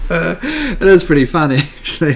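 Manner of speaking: talking through real laughter; amused, with laughs breaking in; laughing. Real, not acted. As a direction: still cracking up, trying to get words out. Still amused by the joke.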